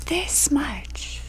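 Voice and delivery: put-on whispered child answer. As a child answering grandma, whispered. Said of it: "And we would go".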